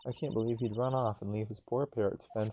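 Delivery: usual speaking voice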